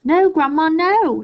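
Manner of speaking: put-on high, loud alarmed boy's cry; calling back. A narrator's reading. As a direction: call back in panic. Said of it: "George called back".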